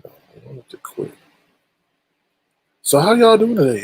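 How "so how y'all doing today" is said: upbeat greeting. Real, not acted. Host re-greets chat after break.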